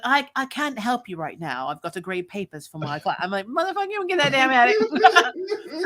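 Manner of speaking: ordinary delivery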